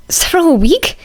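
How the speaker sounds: high, unvoiced wheeze of shock; wheezed disbelief. A narrator's reading. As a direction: gasp it out in disbelief. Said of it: "Izuku wheezed".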